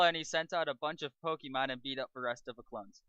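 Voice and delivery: normal talking voice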